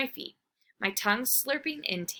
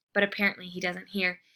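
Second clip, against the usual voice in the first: ordinary delivery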